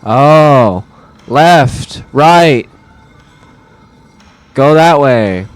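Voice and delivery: loud shouted commands; screaming at a puzzle. Real, not acted. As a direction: bellow each direction at the puzzle. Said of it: "had to scream at the top".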